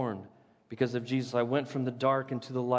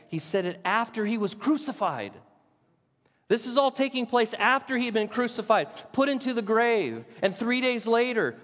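preaching at full force; 9 semitones higher than usual. Acted. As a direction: lift the room with rising fervour.

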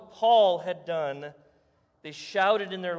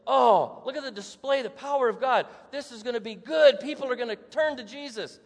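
higher, louder thrilled exclaim; eager excitement; 6 semitones higher, 5 dB louder than usual. Retold or imitated. Apostles imagining the crowd turning to Jesus, thrilled and loud. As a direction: gush with hope at what God is doing.

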